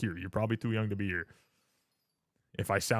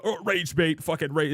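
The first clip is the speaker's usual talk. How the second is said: higher-pitched mock troll; jeering catchphrase imitation; 6 semitones higher than usual. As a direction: parrot the troll's comeback, pitched up. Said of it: "the person will hit them with a".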